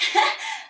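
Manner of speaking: a little high awkward laugh; breathy nervous giggle. Retold or imitated. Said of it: "that little awkward laugh".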